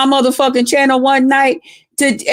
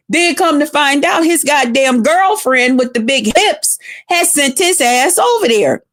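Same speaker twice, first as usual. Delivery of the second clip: ordinary talk, nothing marked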